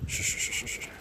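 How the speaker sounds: soft breathy shh to settle the dogs; whispered shushing. Real, not acted. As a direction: hush them gently, barely voiced. Hushing the dogs in the snow.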